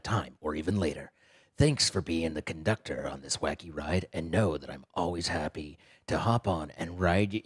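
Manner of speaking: low, quiet, steady reading; Evan impression. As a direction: read it in a deep, subdued voice. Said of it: "did I get your voice right".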